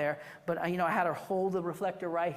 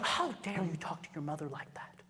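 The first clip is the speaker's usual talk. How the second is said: whispered mock outrage; mock scolding whisper; 4 semitones lower, 6 dB quieter than usual. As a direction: scold the teen in a hushed whisper. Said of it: "I would be like".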